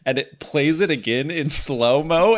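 usual speaking voice

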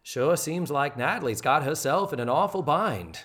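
folksy small-town narrator impression; stage manager. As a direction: put on a homespun stage manager's commentary. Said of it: "you expect a stage manager to walk in front and say".

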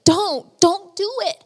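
imitating a wife's high-pitched plea; retold begging. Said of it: "his wife was begging him".

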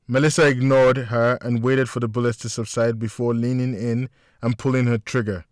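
plain reading voice

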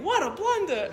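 high, loud Chicago lament; Chicago accent. Retold or imitated. Old man keeps repeating his mistake in a Chicago accent. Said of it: "he kept saying".

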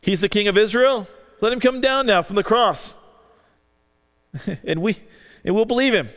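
a crowd's sneering challenge; mocking jeer. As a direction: jeer at him as a bystander would. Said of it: "Mocking him".

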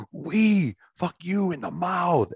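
high, slow mocking mobster voice; hammed-up Russian thug. Teller performs the mobster line, slow and high for the story. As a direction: stretch the line out like a comic gangster. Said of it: "to emphasize how he's speaking".

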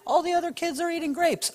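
put-on high, whiny kid's plea; whiny child. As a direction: beg like a sulky boy. Samson whining as boy.

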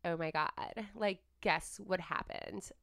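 slow, drawn-out Valley girl mimicry; Valley girl talk. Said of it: "when girls talk like".

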